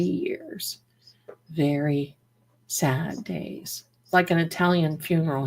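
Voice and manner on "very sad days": sad. Real, not acted. Grieving lost cats.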